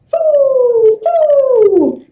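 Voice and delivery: high blown foo like wind; whistling wind. A narrator's reading. Wind whistling at the village. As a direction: blow it out like a whistling breeze.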